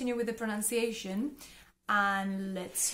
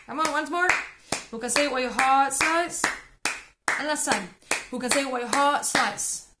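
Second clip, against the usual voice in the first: louder and faster each round; building speed and volume; 3 semitones higher, 7 dB louder than usual. Shown as a demonstration. Teacher speeds up the rhythm drill for learners. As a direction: keep pushing the pace.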